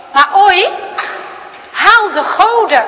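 put-on loud, high, stern old grandfather calling out; grandpa's stern command. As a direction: bark the order across the dinner table. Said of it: "klinkt ineens opa's stem".

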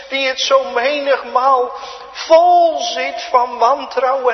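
loud, high, impassioned pulpit delivery; a thundering revival sermon; full-force preaching. Acted. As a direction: preach with swelling fervor.

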